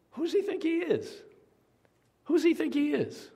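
high, scornful mock of a puffed-up Satan; arrogant Satan. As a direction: sneer it like someone full of himself. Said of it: "He's still arrogant, capital A".

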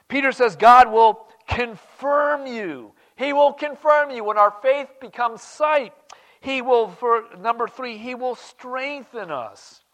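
high, loud, slow fervour; fervent preaching. Acted. Preacher rousing the flock about God's strength, high and loud. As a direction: lift the church with the promise.

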